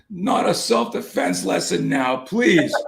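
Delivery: put-on high, drawn-out child's plea; pleading young son. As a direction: imitate a kid begging Dad to stop. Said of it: "he goes".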